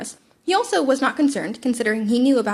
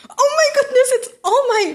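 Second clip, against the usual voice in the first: high, loud squeal of delight; squealing fan; 13 semitones higher, 5 dB louder than usual. A narrator's reading. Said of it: "Midoriya squealed".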